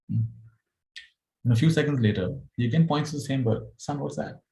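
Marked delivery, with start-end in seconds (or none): 3.8-4.4 a lightly voiced old father, higher, gently asking. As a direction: ask mildly like an aging dad pointing at a bird. Father asks again.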